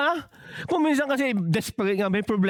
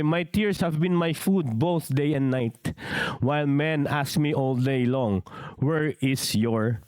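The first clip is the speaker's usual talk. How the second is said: mournful psalm reading; 7 semitones lower, slower than usual. Acted. Preacher quotes psalm of lament.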